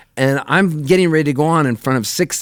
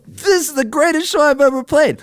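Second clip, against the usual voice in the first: stage announcement. Retold or imitated. Singer on stage telling the audience, high hyped shout. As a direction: hype the crowd from the stage. Same line repeated at another show.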